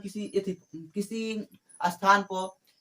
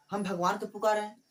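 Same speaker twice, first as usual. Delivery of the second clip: usual speaking voice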